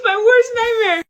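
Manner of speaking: high, loud mock crying; mock wail. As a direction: wail it like a hammed-up breakdown. Mock despair.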